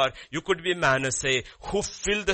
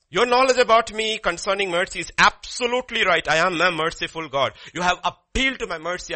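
God's declaration; 4 semitones higher, 7 dB louder than usual. Acted. Preacher voices God's emphatic answer.

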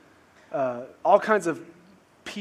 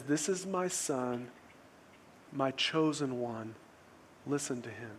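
hushed divine voice; 3 semitones lower, 11 dB quieter than usual. Retold or imitated. Preacher retelling the voice from the cloud, hushed. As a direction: voice it quietly, with weight on each phrase.

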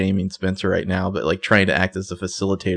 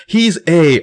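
urgent reveal; 8 semitones higher, 6 dB louder than usual. Retold or imitated. Spencer reveals the villain.

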